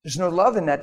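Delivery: normal talking voice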